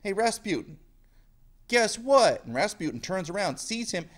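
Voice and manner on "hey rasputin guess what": high, rising taunt, loud on what; taunting call. Retold or imitated. Yusupov taunting Rasputin before shooting, high and loud. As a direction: tease him before the shot. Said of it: "And so he says".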